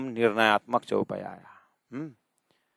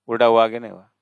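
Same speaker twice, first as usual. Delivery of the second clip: normal talking voice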